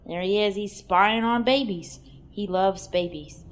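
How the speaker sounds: normal talking voice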